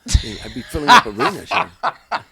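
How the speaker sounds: bursts out laughing, loud and high. Real, not acted. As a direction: laughs through the words at his own joke. Laughing at his own joke.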